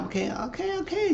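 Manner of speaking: okay okay, fine, giving in; placating okays. Retold or imitated. Danny giving in to Zach, rising placating okays. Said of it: "He said".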